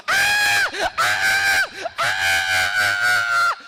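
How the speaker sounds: long loud shrieking falling yell; girlish scream. Retold or imitated. Speaker plunging off the bridge, loud shrieks. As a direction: scream like a scared kid mid-fall. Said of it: "screaming like a twelve-year-old girl".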